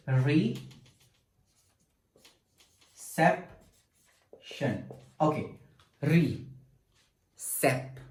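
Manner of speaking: "reception" in isolated syllables with long pauses; each syllable separately. Shown as a demonstration. Teacher writing out syllables, "reception" said piece by piece. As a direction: leave long gaps inside "reception": re, cep, tion. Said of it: "let's pronunciate each syllable separately".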